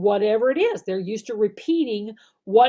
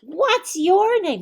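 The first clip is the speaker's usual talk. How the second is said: higher, louder puppet asking; 8 semitones higher, 6 dB louder, slower than usual. Shown as a demonstration. Puppet Dino asking the class, higher and louder. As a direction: let the puppet ask, bright and slow. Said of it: "Let Dino ask the question".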